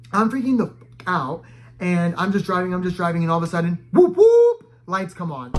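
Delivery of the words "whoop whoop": high, loud siren whoops; imitated police siren. Storyteller mimicking a police car pulling them over, siren whoops.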